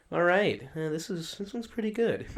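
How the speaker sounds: pleased taster's verdict, pitched high; Steve1989MREInfo impression. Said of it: "he talks like that too".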